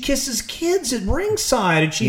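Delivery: high, slow disbelieving rant; incredulous. Retold or imitated. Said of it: "I remember watching that mugging and being like".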